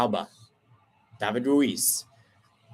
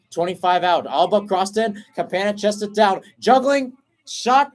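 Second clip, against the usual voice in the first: climbing higher and louder with each touch until the shot; building excitement; 6 semitones higher, 4 dB louder than usual. Real, not acted. Commentator calling Miami's late attack, building excitement. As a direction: ride the attack up, then peak on the shot.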